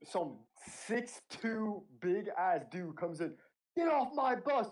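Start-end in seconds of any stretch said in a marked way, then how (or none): 3.8-4.7 high barked order, full force; drill sergeant shout. Retold or imitated. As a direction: yell it like a drill sergeant off the bus. Drill sergeant yelling at recruits.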